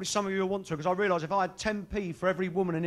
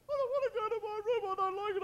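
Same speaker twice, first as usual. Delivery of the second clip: high, softer sulky whine; whiny child; 11 semitones higher, 5 dB quieter than usual. Retold or imitated. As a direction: sulk like a kid. Child protesting being sent upstairs.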